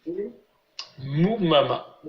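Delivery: slow syllable drill, vowels held; récitation modèle. Shown as a demonstration. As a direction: repeat it measuredly as a model for pupils. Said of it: "Je répète". (répétition lente, syllabe par syllabe)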